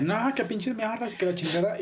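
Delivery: mock outraged griping; griping hater. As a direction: imitate a hater moaning. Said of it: "van a empezar a mamar de que".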